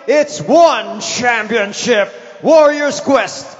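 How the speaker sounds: booming, drawn-out arena announcement; ring announcer. Acted. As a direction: boom it out to the whole stadium. Ring announcer opening the show.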